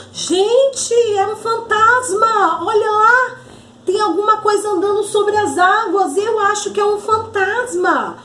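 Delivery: high-pitched storybook alarm; alarmed cry. Acted. João warns the disciples of a ghost, alarmed shouting. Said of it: "ele logo grita".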